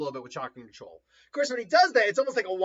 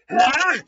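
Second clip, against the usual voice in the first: sudden loud, high "ah"; sharp acted cry of alarm; startled yell; 7 semitones higher, 12 dB louder than usual. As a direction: yelp as if attacked. Acted cry as clones attack.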